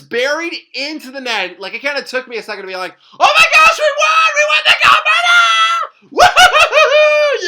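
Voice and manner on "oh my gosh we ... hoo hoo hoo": high, loud ecstatic screaming; shrieking jackpot winner. Real, not acted. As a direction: shriek it out, you just won. Celebrating winning the gold.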